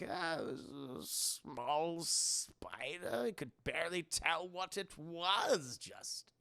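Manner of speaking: low, very soft, slow evasion; quiet, creepy vague answer; sinister host. Retold or imitated. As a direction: murmur it slowly, trailing away. Howard evading question about the spider.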